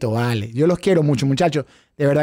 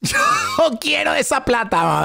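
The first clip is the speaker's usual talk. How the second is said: laughing; 7 semitones higher, much slower than usual. Real, not acted. Jokes about wanting money.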